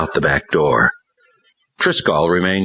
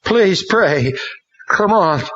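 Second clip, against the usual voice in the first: much higher pleading voice; pleading boy; slower than usual. A narrator's reading. Ron pleading with Hank, much higher. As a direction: beg urgently. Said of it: "and then pleaded".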